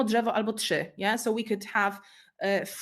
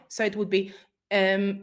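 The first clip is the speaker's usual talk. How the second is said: normal talking voice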